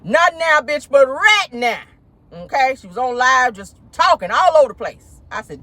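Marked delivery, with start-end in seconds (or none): 0.0-1.8 slow, insistent mock producer; demanding call me right now; bossy producer. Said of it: "producer interrupted her live and said".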